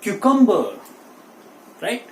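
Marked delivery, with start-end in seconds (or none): 0.0-0.6 "cucumber" as KYOO-kum-buh, high and falling; correct pronunciation. Shown as a demonstration. Teacher revealing the right cucumber, said KYOO-kum-buh. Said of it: "the right pronunciation for this word is".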